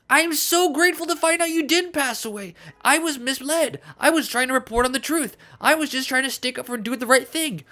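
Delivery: high-pitched, defensive mock pleading; distressed pleading. Pleading defence against acted angry accuser.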